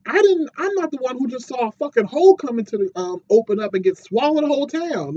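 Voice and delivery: high, loud indignant protest; defensive Robot Man. Retold or imitated. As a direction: whine back that you're the one who saw it. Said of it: "And he's like".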